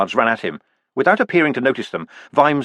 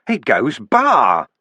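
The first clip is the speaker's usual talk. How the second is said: weeping; much slower than usual. Acted. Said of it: "it wept".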